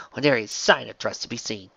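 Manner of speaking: plain reading voice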